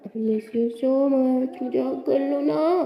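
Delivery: slow, loving plea for a hug; tender pleading. Acted. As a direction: coax her gently, slowly. (गले लगने की धीमी प्यार भरी मिन्नत)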